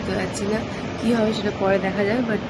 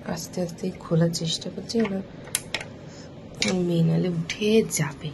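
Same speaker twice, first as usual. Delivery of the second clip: whisper; 4 dB quieter than usual. Real, not acted. Opening window, daughter sleeping.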